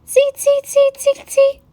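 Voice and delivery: very high monkey squeaks; chattering monkeys. A narrator's reading. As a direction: squeak back like cheeky monkeys. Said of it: "monkeys ... and said".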